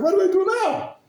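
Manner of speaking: high, loud desperate cry; father begging the rebbe, high and loud; desperate question. Retold or imitated. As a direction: plead for help at full pitch.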